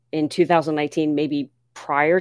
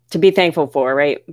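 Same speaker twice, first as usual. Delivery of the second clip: usual speaking voice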